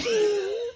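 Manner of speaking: high squealing laugh at own joke; real laugh after a silly word. Real, not acted. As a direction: burst into a high-pitched laugh.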